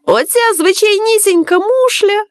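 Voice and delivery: high, incredulous scoff; child's derisive snicker, lightly acted; scoffing amusement. A narrator's reading. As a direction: pitch it up in mocking disbelief. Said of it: "пирснув Капуле".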